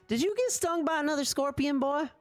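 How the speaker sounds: high worried mother; mom voice. Acted. Mother checking her son's forehead for fever, high worried voice. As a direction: fret like a mom feeling for fever.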